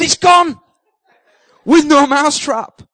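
high, loud triumphant yell; bursting with relief it's gone; excited shout. Retold or imitated. Yelling to his wife that the rat is out, shouting.

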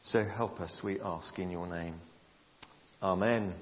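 soft, low closing prayer; quiet prayer. Acted. Pastor closing his prayer to the congregation, soft and prayerful. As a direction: pray it gently to a close.

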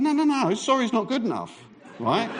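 high, indignant refusal; acted anger. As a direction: reject the apology in mock outrage. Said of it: "I'm getting quite angry".

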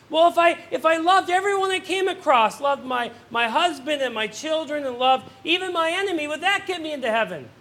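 a keen teacher pleading her case; eager teacher. Acted. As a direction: push the teacher's hope up. Teacher voiced in joke.

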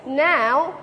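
loud, high call for attention. Real, not acted. Preacher calling the chatting congregation back, loud and high. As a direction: raise the voice to bring the room back to order.

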